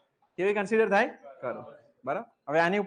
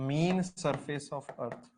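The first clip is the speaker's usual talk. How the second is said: very slow, softer repeat of the dictated words; slow dictation; 6 semitones lower, 8 dB quieter, much slower than usual. Shown as a demonstration. Teacher repeating a note to the class, slow and quiet. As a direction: spell it out slowly for note-taking. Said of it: "teacher repeats the dictated note".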